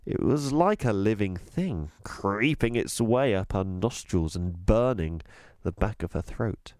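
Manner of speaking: ordinary delivery, nothing marked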